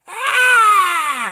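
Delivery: high mock screech; retold screech. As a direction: shriek it like a hit villain. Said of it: "and she's like".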